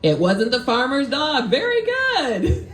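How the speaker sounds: real delight, pitch climbing to the end; delighted praise. Real, not acted. Praising a correct riddle answer while laughing.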